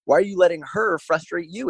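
high-pitched, incredulous questioning; exasperated scolding. Retold or imitated. Acted scolding of comparing client.

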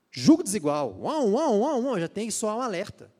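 loud, high alarmed warning; alarm call. Real, not acted. Preacher sounding the alarm to young women, loud alarmed warning.

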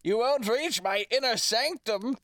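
loud, high, echoing villain boast; disembodied voice. Pretend. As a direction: gloat like an unseen evil lich. Mimicking the demi-lich voice.

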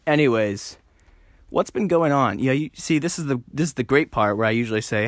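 ordinary talk

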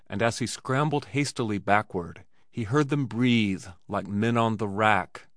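ordinary delivery, nothing marked

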